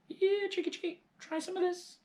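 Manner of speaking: very high sing-song coax; coaxing chickie call. Acted. Boy coaxing the brown hen, very high sing-song.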